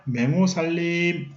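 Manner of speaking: 맹호살림 stretched out slowly, syllable by syllable; slow drawn-out term. Shown as a demonstration. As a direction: spell out 맹호살림 slowly for the class. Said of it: "teacher repeats term slowly".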